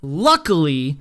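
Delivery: usual speaking voice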